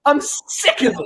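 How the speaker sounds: breaking into a laughing yell; fed up, half-laughing, half-shouting; a laughing mock outburst; laughing exasperated shout. Real, not acted.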